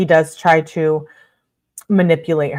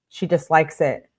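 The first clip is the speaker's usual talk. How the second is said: normal talking voice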